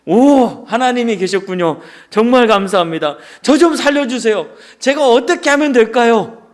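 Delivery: acted excited plea to God; relieved pleading. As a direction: grab at the rescuer's voice with relief. Man relieved, begging God.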